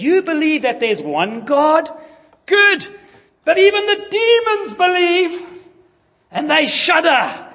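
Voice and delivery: high, loud ironic "Good"; mock approval. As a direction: tease the listener, then warn. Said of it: "verse nineteen says".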